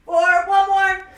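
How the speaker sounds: slow, loud coach count. Real, not acted. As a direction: stretch out the final count to push them. Coach counting final reps.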